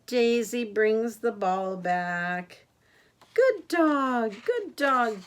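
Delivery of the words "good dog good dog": higher, louder good-dog praise; praising a dog. Acted. Grandma voicing the owner praising Daisy, warm and bright. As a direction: cheer the dog like its proud owner.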